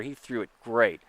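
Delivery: usual speaking voice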